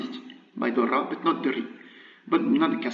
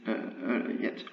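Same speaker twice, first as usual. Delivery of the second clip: ordinary talk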